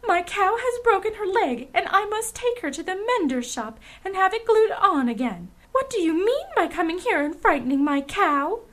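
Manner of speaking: high, loud scolding; angry milkmaid. A narrator's reading. Milkmaid scolding strangers over her broken cow, angrily. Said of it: "cried the milkmaid angrily".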